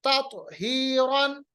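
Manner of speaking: slow तात हीरन with echoed t; qalqalah. Shown as a demonstration. Teacher demonstrates qalqalah, तात हीरन with returning t. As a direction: bounce तात into "tato", lengthen हीरन. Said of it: "कलकला".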